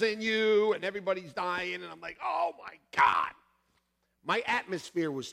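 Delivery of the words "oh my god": shocked gasp. Retold or imitated. Shocked by the needle room.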